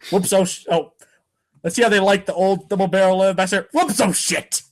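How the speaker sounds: ordinary talk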